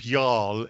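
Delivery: "Jarl" as a British y'all, long ah and no drawl; too-posh y'all. Shown as a demonstration. Briton slipping in a Southern word, posh "Jarl" for y'all. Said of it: "for pronouncing y'all quite the way you did".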